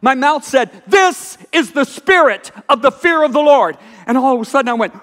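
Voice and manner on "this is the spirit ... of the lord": slower, ringing declaration; proclaimed revelation. Retold or imitated. His mouth declaring to the room, slow and ringing. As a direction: announce it like a prophecy.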